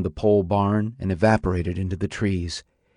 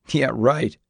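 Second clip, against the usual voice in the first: higher-pitched scornful scoff; disdainful; 4 semitones higher than usual. A narrator's reading. Son dismissing his father's reassurance, disdainful. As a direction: brush him off with disdain. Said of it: "said disdainfully".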